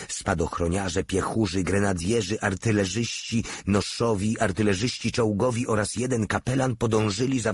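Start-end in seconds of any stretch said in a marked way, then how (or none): none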